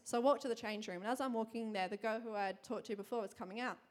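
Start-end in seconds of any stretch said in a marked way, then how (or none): none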